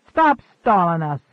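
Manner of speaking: loud, curt snap; snapped. A narrator's reading. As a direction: cut him off impatiently. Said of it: "snapped the blond man".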